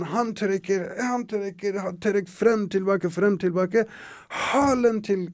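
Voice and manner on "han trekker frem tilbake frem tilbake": plain reading voice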